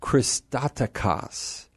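"Christotokos" said slowly and softly, each syllable clear; slow teaching model of the Greek word; careful pronunciation. Shown as a demonstration. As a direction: lay out "Christotokos" deliberately with the accent on "to". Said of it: "we know where the accent is".

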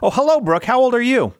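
high, loud, delighted hello; cheerful greeting. Real, not acted. Host greets young child caller.

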